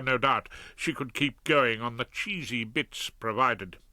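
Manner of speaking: plain reading voice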